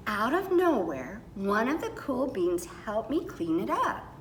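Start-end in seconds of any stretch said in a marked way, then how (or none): none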